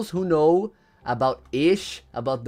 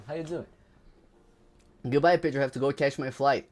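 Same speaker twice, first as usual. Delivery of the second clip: usual speaking voice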